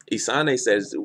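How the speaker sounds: ordinary talk, nothing marked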